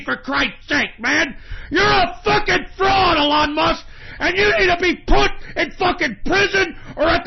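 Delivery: screaming in real rage, high and loud; real furious screaming rant; furious shout. Real, not acted. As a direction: yell the insults with all your fury. Furious rant at Elon Musk.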